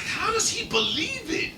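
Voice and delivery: very high, slow disbelief; mock amazement. Retelling Spank's amazement at the prank, mock amazement. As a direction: imitate someone amazed, stretching the words.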